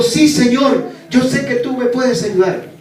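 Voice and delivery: the hanging climber answers the Lord's voice, pleading; eager pleading. Acted. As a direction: insist that he believes. Man answers God pleading.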